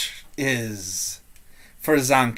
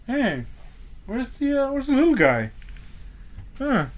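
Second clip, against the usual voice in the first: lightly voiced, higher puzzled guard; wondering guard; 8 semitones higher, 4 dB quieter than usual. Guard wonders where the little guy went, puzzled. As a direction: wonder aloud, voice raised in pitch. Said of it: "is just wondering".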